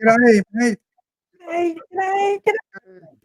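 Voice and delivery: very high, squeaky helium g'day. Pretend. Showing off voice effects to a listener, helium voice. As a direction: sound like you have sucked on a helium balloon. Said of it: "thought it was a bottle of helium".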